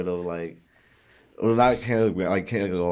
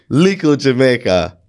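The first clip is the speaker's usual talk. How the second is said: Lico Jamaica rolled out louder with Jamaican lilt; mock Jamaican ad voice; 5 dB louder than usual. Shown as a demonstration. Friend asks him to say it again, mock Jamaican ad read. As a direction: sell Lico Jamaica like an island ad. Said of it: "One more time".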